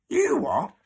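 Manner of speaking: high, loud incredulous demand; blunt demand. Acted. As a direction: fire it back in disbelief. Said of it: "demanded Dilly".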